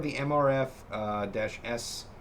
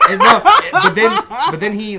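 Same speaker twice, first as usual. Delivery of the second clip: high burst of laughter; laughing; 9 semitones higher, 16 dB louder than usual. Real, not acted. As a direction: laugh through the words. Laughing at own story.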